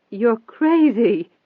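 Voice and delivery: slow, high, loud, trembling denial. A narrator's reading. Cornered woman denying the reporter's accusation, trembling. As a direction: let the voice tremble as it rises. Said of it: "Her voice was trembling badly".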